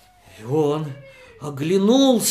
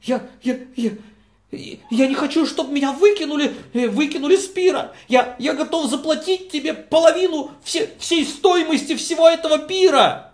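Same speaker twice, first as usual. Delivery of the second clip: higher, hurried pleading; the guest bargaining with the host, higher and fast; 8 semitones higher, faster than usual. Acted. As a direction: haggle desperately to stay. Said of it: "Он сказал".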